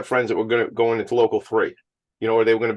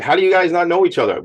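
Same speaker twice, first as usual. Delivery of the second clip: mock surprise; 8 semitones higher, 5 dB louder than usual. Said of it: "And you're like".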